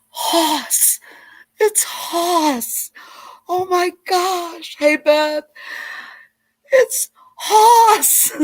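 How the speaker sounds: high, loud excited squeal; starstruck shout. Retold or imitated. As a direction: yell it like a kid meeting her hero. Child recognizes Hoss at lunch.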